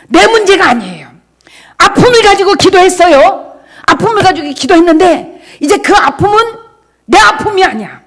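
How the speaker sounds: loud, high exhortation; full-force preaching. Acted. Pastor assuring believers their pain is God's, loud preaching. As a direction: drive the point home to the pews.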